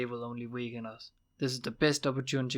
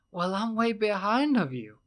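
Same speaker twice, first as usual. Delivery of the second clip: high, loud frustrated outburst; bursting out; 8 semitones higher, 5 dB louder than usual. A narrator's reading. Will, lost in the conversation, bursts out loud and high. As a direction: blurt it out, fed up with the riddles. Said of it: "he burst out".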